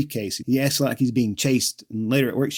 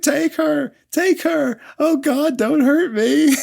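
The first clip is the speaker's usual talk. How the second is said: high, panicky pleading of a coward; cowardly pleading; 13 semitones higher, slower than usual. Retold or imitated. Simon begging his captors, high panicky cowardly pleading. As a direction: beg like a terrified coward. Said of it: "he's such a coward".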